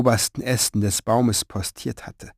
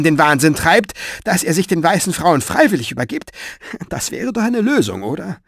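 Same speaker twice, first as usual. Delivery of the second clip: sarcastic; 6 semitones higher, 4 dB louder than usual. Acted. Old writer mocks his critic.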